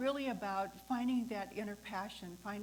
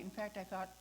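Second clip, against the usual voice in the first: ordinary talk, nothing marked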